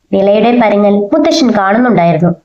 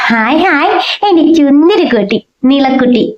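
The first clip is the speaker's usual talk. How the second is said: put-on gleeful childlike cheer; 3 semitones higher than usual. A narrator's reading. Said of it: "അവൻ കൈകൊട്ടി ചിരിച്ചു".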